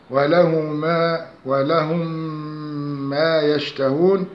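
slow recitation, ولهم ما joined on a long humming m; demonstrated tajweed merging. Shown as a demonstration. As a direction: draw out the doubled nasal meem from ولهم into ما. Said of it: "هكذا".

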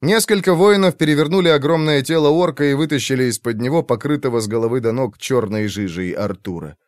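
plain reading voice

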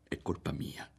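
whispered self-reproach; to himself. Acted. Boy blaming himself under his breath, whispered. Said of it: "si diceva".

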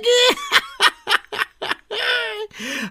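high, loud cackling laugh; raucous laughter. Acted. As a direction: howl with mocking laughter. Gloating laughter. (harsány röhögés)

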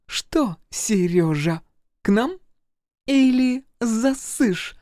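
high, halting teasing invitation; rhythmically moving. A narrator's reading. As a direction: tease in broken, drawn-out bursts while moving. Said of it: "ритмично двигалась Ира". (прерывисто, в такт движениям)

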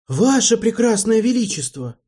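high, loud indignant protest. Acted. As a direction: protest in outrage. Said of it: "возмутился Марбл".

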